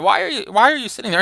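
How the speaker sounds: lightly acted, hurried reproach; chiding. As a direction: imitate an impatient telling-off. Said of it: "he chides Falstaff".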